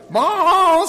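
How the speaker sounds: high, loud, drawn-out bellow; bawling calf. A narrator's reading. Star the calf as the sled bounces, bawling. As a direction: bawl like a startled calf. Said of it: "said Bright".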